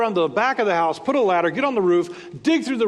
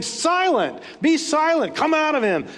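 higher, sharper rebuke, slowing on Silent; stern rebuke; 9 semitones higher than usual. A narrator's reading. Preacher reading Jesus rebuking the unclean spirit, stern and raised. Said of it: "But Jesus rebuked him".

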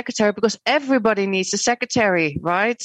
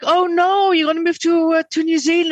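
mock-alarmed mother, much higher and louder; 10 semitones higher, 4 dB louder than usual. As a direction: play the worried mum hearing bad news. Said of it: "And she was like".

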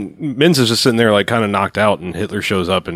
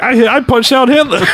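proud soldier; 13 semitones higher, 4 dB louder than usual. Retold or imitated. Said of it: "walks around the rest of the movie like".

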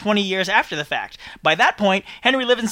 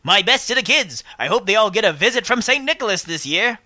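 ordinary delivery

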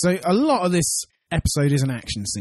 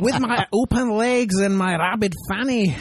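a mock Scottish Amy, pitched up; a hint of Scottish, higher, half laughing; Scottish accent; 6 semitones higher than usual. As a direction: put on a bad, hammy Scottish accent. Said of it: "I wish I could do the accent".

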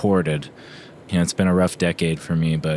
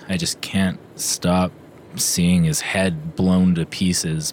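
slow, faltering recollection; haunted slow words; slower than usual. Acted. Coach telling the doctor what he can't stop seeing.

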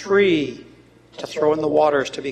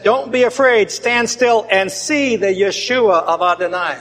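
"Don't be afraid" called out louder, pitched up; proclaiming boldly; 4 semitones higher, 6 dB louder than usual. Acted. Moses calls out to the frightened people, loud and bold. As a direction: declare it to the crowd with force. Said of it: "Moses said".

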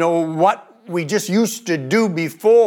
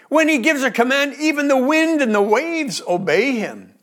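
higher, quicker awed exclamation; amazed; 7 semitones higher than usual. A narrator's reading. Disciples marvelling that the wind obeys him, higher amazement. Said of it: "The disciples were terrified and amazed".

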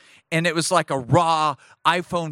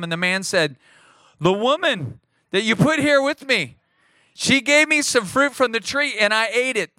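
higher, livelier reading of the garden dialogue; dramatic scripture reading; 5 semitones higher than usual. Acted. Pastor reading the garden dialogue aloud, higher and livelier. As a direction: lift the voice for Adam's excuses. Reads Adam and God dialogue.

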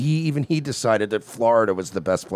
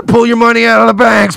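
urgent shout; 11 semitones higher, 9 dB louder than usual. Acted. Urging a bank run.

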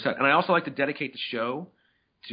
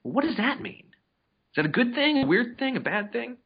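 puzzled wondering; 11 semitones higher than usual. Retold or imitated. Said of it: "look at those things and go".

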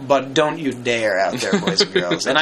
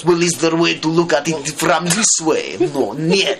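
put-on higher, thick Russian accent; hammed-up Russian villain; evil Russian voice; 3 semitones higher than usual. Podcaster playing a Soviet censor, evil Russian voice. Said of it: "Sorry, I'll do my evil Russian voice".

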